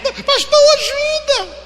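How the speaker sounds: high whimpering plea; tearful plea. Retold or imitated. Friend begging the pastor for help, high tearful whimper. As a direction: imitate a tearful woman begging. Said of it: "em lágrimas também".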